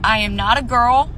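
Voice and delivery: ordinary delivery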